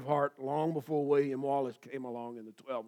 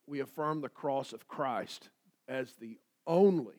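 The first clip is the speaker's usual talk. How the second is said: preacher punching only; stress on only. Shown as a demonstration. Preacher stressing only to the congregation. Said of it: "emphasis on only".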